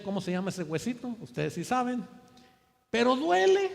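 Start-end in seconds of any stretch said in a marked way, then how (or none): 2.9-3.7 dolor fingido. Retold or imitated. Recuerda el dolor de rodilla.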